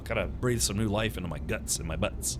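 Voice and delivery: ordinary talk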